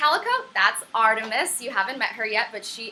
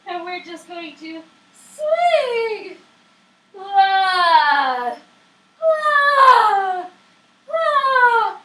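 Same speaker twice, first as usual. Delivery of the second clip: high, loud swinging ah from the hang; flung ah sounds; 8 semitones higher, 9 dB louder, much slower than usual. Shown as a demonstration. Teacher repeating the hanging exercise with loud flung ahs. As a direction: swing the voice out on big open ahs. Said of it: "one more time, we're going to hang".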